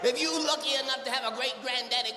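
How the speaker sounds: ordinary talk, nothing marked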